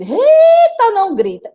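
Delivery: high loud shout in her ear; classmates yelling. Acted. Classmates shouting back into Rita's ear, high and loud. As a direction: yell it right into her ear. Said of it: "no ouvido".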